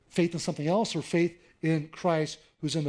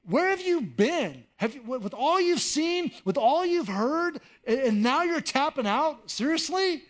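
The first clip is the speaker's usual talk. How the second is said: higher, slower, incredulous rebuke; exasperated Jesus; 8 semitones higher than usual. Acted. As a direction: friend who can't believe you're quitting. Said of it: "it's almost like Jesus saying".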